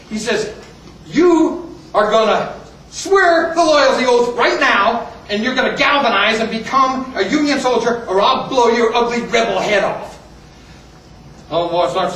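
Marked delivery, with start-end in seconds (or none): 1.1-10.2 raised, emphatic threatening order; acted fury, higher and louder; furious colonel. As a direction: threaten him as an enraged officer. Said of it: "colonel's face turns red as a, he goes nuts".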